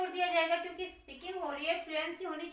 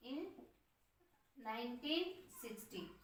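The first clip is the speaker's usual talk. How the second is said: softer, each word drawn out to be written down; slow dictation; 3 semitones lower, 11 dB quieter than usual. Shown as a demonstration. Teacher dictating the year to students, softly and slowly. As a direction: read out slowly for the class to copy. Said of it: "लिख सकते हैं".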